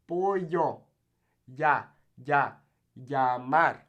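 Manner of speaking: "Pollo" as po-yo, "Llamar" as ya-MAR; Y sound. Shown as a demonstration. Teacher drills double L, Spanish Y sound. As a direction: break out the "ya" in "Llamar". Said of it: "The Y".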